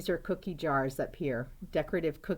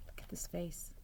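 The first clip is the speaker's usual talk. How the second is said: whisper; 12 dB quieter than usual. Real, not acted. Whispered aside.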